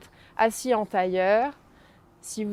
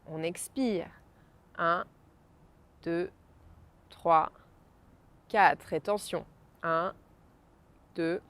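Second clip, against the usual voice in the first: soft, slow, spaced counting; guided breathing count; 2 semitones lower, 4 dB quieter than usual. Acted. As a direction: count each breath calmly and slowly. Counts breaths slowly, softly. (décompte lent et doux de respiration)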